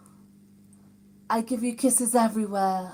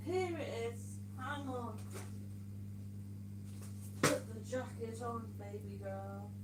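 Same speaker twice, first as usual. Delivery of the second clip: far from mic; 2 semitones lower, 17 dB quieter than usual. Real, not acted. Walks away from mic.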